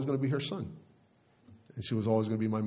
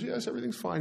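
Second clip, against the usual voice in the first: very high, softer mock-weepy voice; imitated tearful mother; 11 semitones higher, 4 dB quieter, faster than usual. Crying mother reassuring worried husband, mock tearful. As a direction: mimic a weepy mother, pitched right up. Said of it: "And she was just sobbing".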